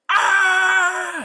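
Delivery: long, high, loud yelp; scream of pain. Acted. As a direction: scream as if bitten from behind. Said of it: "he screams and jumps upward in pain".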